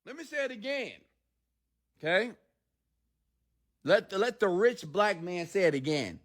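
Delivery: high theatrical voice; lightly hammed-up preacher; pompous self-announcing; mock grand preacher. After prank scream, mock preacher.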